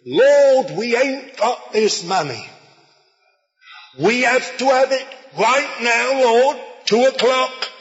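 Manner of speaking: put-on high, loud, urgent old seaman's plea; mock cockney praying. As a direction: cry out to the Lord like a desperate old sailor. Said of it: "this old cockney, old man who'd been a seaman".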